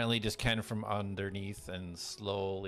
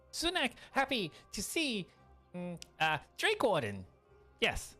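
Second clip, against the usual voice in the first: high goblin voice; 11 semitones higher than usual. Acted.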